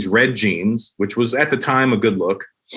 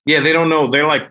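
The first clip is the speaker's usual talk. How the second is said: usual speaking voice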